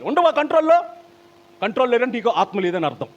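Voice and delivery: high, loud pulpit challenge; preacher at full force; forceful preaching. Real, not acted. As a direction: press the congregation hard.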